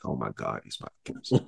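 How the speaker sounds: very low, soft near-whisper; breathy mock-fearful aside; hushed dread. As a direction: retell the dread, trailing into a whisper. Retold dread watching gunman question captives.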